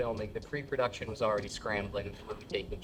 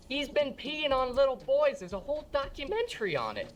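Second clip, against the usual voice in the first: mom voice; 13 semitones higher than usual. Retold or imitated. Mom warns her son about the singer.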